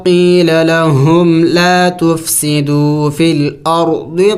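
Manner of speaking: slow, melodic tajweed chant; measured Quran recitation with long vowels; Quranic recitation. Shown as a demonstration. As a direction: recite the verse in full, slowly. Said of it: "ملا کے پڑھتے ہیں".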